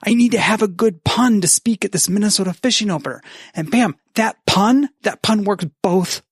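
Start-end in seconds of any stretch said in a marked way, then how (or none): 0.0-3.2 mock excited. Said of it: "if you're like".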